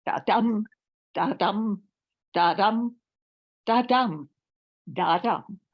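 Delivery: da-DUM stressed on the second syllable each time, short then long; iambic beat. Acted. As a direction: tap out the iambic rhythm like chalk on a board. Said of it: "proving with chalk that poetry has feet".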